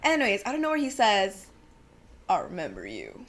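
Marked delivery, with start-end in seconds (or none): 2.3-3.2 low, quiet country drawl imitation; deep, hushed mock country accent. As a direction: imitate a creepy deep-voiced country man, dropping way down. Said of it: "in this deep country accent".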